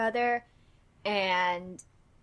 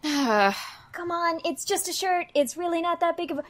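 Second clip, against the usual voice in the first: high, quick placating plea; pleading to calm; 7 semitones higher, 4 dB louder than usual. Acted. Cecil playing down the stained shirt, high pleading. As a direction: rush to talk her down in a high voice. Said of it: "trying to calm her down".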